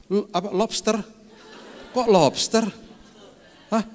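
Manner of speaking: high, bewildered "lobster?"; puzzled mishearing. Real, not acted. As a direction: ask "lobster?" in disbelief. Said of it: "Saya salah dengar kali ya". (heran, "lobster?" dengan nada tinggi)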